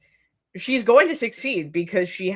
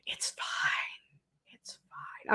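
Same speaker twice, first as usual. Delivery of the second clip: mock-reassuring whisper to herself; 12 dB quieter, much slower than usual. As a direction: breathe it out, half joking, barely voiced. Laughing off a grim plot twist.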